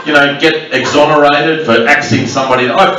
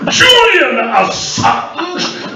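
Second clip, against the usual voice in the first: huge, drawn-out theatrical cry, much higher; Shakespearean actor; much slower than usual. Retold or imitated. Comic retelling Geoffrey Robertson's grand theatrical greeting, hammed-up shout. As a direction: declaim it like a ham Shakespearean actor. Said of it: "He turned into a Shakespearean actor".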